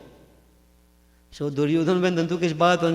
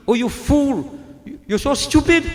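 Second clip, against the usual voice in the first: a mild mock outburst, higher; angry outburst; 7 semitones higher, 4 dB louder than usual. Gandhari furious at Krishna's trick, higher mock anger. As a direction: snap at him in hammed-up anger, higher. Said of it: "Gandhari got very angry".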